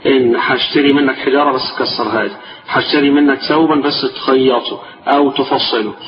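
ordinary delivery

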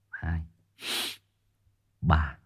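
ordinary talk

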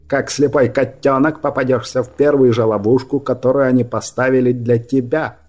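forceful rebuke from a mage; exasperated scolding. A narrator's reading. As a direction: scold him in disbelief. (возмущённая отповедь)

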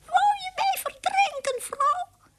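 very high, indignant squeak; squeaky mouse. A narrator's reading. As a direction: squeak in alarm at your wife. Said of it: "riep hij".

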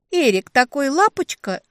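high, loud, cutesy gushing; gushing baby talk. A narrator's reading. Little sister praising her boyfriend, gushing baby talk. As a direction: gush like a smitten little sister. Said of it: "засюсюкала сестрёнка".

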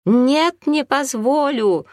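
high, loud protesting cry, slowing on the last words; shout. Acted. Наталья Владимировна refusing her rival, a loud shout. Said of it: "услышал однажды Артём крик".

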